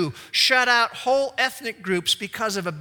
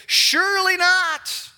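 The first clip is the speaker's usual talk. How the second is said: much higher, louder, slow "Surely not"; indignant refusal; 10 semitones higher, 6 dB louder, slower than usual. Acted. Peter refusing God, high drawn-out protest. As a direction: stretch it out in disbelief. NIV rendering acted out.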